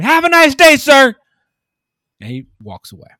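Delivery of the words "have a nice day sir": much higher, much louder sarcastic scream. Shown as a demonstration. Clerk screaming a sarcastic goodbye at the rude customer. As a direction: scream it like a smart ass. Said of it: "you just scream".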